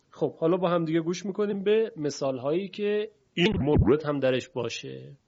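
normal talking voice

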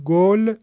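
گل with a rounded short-vowel 'o'; Persian damma. Shown as a demonstration. Teacher showing students damma, the 'o' of گل. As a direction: say گل with 'o', not 'oo'. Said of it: "اما در مورد ضمه".

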